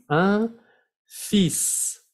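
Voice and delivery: "Un fils" in two high, separate words; article demo. Shown as a demonstration. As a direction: pause after a nasal "Un", then "feess" on "fils". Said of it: "cuídense también con el artículo".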